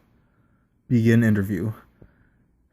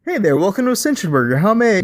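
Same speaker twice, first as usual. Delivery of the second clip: high, fast, chirpy service-counter pitch; perky drive-thru voice; cheery fast-food greeter; 9 semitones higher, 4 dB louder, faster than usual. Acted. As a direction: beam the scripted welcome at the customer. Chipper burger counter greeting.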